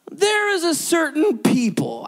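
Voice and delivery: silky villain impression with stretched words; Scar. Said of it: "he sounded like Scar from The Lion King".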